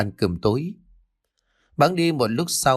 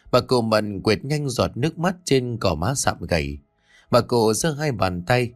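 ordinary delivery, nothing marked

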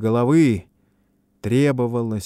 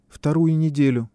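put-on ringing young voice; 6 semitones higher than usual. A narrator's reading. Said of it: "отозвался звонкий, молодой".

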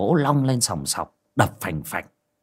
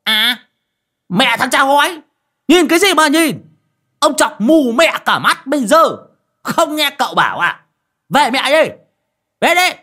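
high, loud, snarling threats; a rough man spitting curses; hissing fury; 7 dB louder than usual. Acted. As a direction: snap at the staring neighbour. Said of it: "rít lên".

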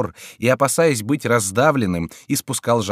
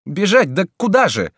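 much higher, louder flustered voice; Friar's anxious question; 10 semitones higher, 5 dB louder than usual. A narrator's reading. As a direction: ask in a fluster. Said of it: "спросил Фриар".

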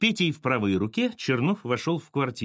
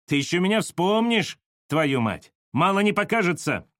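raised, high-pitched threat; parting threat; 6 semitones higher, 3 dB louder than usual. A narrator's reading. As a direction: throw a last threat over your shoulder. Petka threatens on leaving.

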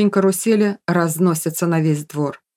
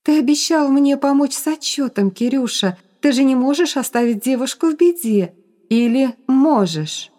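put-on higher, lightly eerie girl's voice; coaxing ghost; 6 semitones higher than usual. A narrator's reading. Ghost reminds Kirill of his promise, coaxing. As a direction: coax him, slowing on the last question.

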